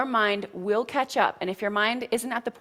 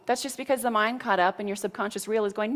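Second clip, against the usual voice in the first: ordinary talk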